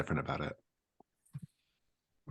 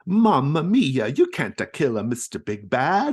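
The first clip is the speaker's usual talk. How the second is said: slow, higher, louder Italian exclamation; Italian pizza chef; 9 semitones higher, 11 dB louder than usual. Acted. Pizza chef exclaims in an Italian accent. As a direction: stretch out Mamma mia like an Italian chef. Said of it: "Vincenzo, the Italian pizza chef".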